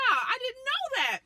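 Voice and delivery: high, slow surprised wonder. Retold or imitated. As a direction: sound amazed by the news. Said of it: "And I was like".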